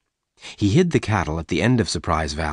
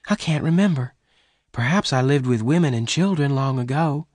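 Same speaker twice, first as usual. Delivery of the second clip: put-on higher-pitched young woman's voice; Bess, unsure; 7 semitones higher than usual. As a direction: voice Bess higher, searching her memory.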